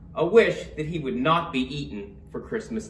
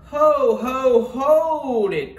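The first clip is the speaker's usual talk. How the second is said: drawn-out, high call to halt; elf's cry; 8 semitones higher, 6 dB louder, much slower than usual. A narrator's reading. Elf halting Turkey at Santa's village, loud drawn-out cry. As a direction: call out loud and high, stretching each word. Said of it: "cried the elf".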